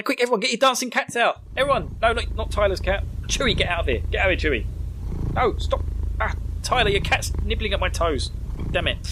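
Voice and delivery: high, urgent calling out; mock commotion. Host calling cats to dance at title music, mock commotion. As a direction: shoo a pet in hammed-up alarm. Pretend panic during the title sequence.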